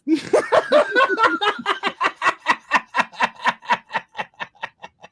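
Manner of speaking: long, high giggling fit; real laughter. Real, not acted. Cracking up at own dingo joke, real high laughter. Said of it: "I crack me up".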